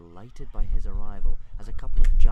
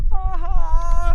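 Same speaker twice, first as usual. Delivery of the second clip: high, loud wail of dismay; high drawn-out cry; 7 dB louder than usual. A narrator's reading. Hiccup sees sick on Stoic's pillow, high dismayed cry.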